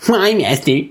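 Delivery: lightly whining mock apprentice; whiny. Apprentice questioning his master, whiny. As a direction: put on a mild, plaintive whine.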